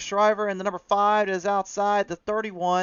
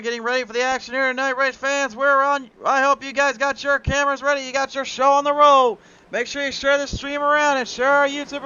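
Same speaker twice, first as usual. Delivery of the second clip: higher and louder, pumped-up race intro; hype race announcer; 6 semitones higher, 4 dB louder than usual. Acted. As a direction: whip the crowd up for the green flag. Hypes the race start.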